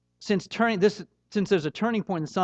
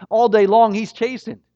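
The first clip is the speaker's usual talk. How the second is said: preached emphasis; 4 semitones higher, 8 dB louder than usual. Acted. Preacher drives home the point.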